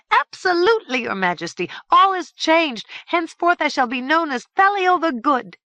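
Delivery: mock-contrite fairy. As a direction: overplay the promise to be good. Mischievous fairy mock-repents.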